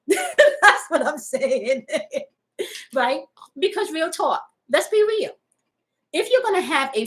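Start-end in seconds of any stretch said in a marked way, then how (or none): 0.1-1.9 slow, laughing through it; amused laughing agreement. Real, not acted. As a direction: chuckle the words out.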